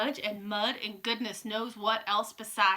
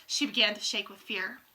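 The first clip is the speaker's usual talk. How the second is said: usual speaking voice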